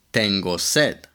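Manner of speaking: ordinary delivery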